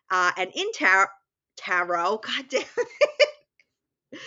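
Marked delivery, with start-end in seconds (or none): 2.2-4.2 laughing. Real, not acted. Stumbles over tarot, laughs.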